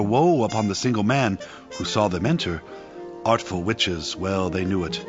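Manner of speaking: ordinary talk, nothing marked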